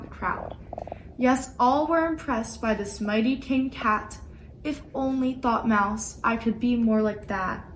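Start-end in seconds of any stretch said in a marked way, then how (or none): none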